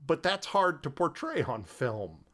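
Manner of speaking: ordinary delivery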